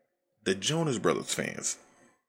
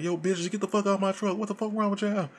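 furious yelling; 12 semitones higher, faster than usual. Retold or imitated. Joe yelling at fans mobbing his truck, high furious yell. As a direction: go off on them like he's defending his truck. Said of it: "He's going the hell off".

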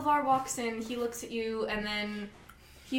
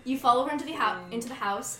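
ordinary delivery

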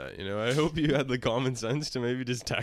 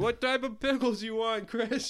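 high-pitched mimicked holler; mock store scream; 10 semitones higher than usual. Teasing a friend who screams in Walmart, high mock yell. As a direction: imitate a friend calling across aisles. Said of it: "I'm very good at screaming".

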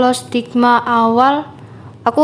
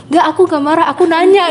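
higher and louder, insisting she's only asking; defensive protest; 6 semitones higher, 3 dB louder than usual. Retold or imitated. To a Javanese friend who thinks she's angry, higher and louder protest. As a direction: protest that you're not angry, just asking. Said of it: "aku bilang".

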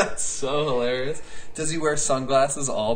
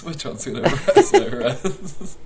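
higher, slower, breaking up with laughter; laughing mock quote; 7 semitones higher, 4 dB louder than usual. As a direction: crack up while playing the mocked reader. Repeats mocking bit, breaking into laughter.

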